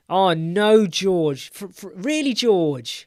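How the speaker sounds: high, loud startled dismay; mock disbelief. Real, not acted. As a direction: react with playful shock.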